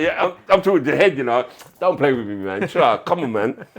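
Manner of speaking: excited disbelief. Retold or imitated. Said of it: "I was like".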